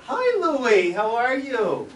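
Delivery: high, loud greeting in a mother's voice; mother on phone. Retold or imitated. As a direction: greet a caller in a high singsong. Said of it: "My mother answered the phone".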